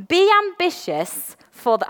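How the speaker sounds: slow start of the reading. Shown as a demonstration. Reading scripture to the church, slow. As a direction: read it slow. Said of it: "I'm gonna read it slow".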